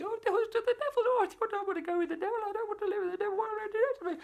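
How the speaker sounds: very high, fast frightened wail; a terrified girl pleading not to go with the devil, very high and fast; panicked crying girl. Acted. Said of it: "She's crying her eyes out still".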